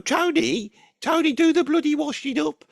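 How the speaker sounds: calling Tony, high and loud; a Brummie mum shouting up the stairs; nagging mum. Retold or imitated. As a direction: mum yelling at her son to do the washing up.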